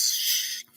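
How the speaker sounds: hushed warning shush; a soft voiceless shush. Retold or imitated. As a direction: hush them before anyone hears. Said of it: "the mentality is".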